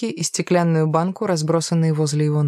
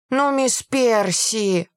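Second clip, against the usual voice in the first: put-on slow, higher-pitched childish whine; whining; 5 semitones higher, much slower than usual. A narrator's reading. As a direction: drag it out like a begging boy. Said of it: "ныл Рид".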